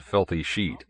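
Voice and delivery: usual speaking voice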